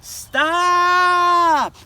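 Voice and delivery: long, high, loud desperate stop; yell. Acted. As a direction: yell it at the runaway pot. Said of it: "yelled Big Anthony".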